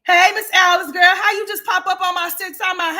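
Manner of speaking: high, loud shout of welcome; loud greeting. Real, not acted. A streamer hails a viewer who just dropped into the stream, shouting. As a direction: call out to her like a surprised friend.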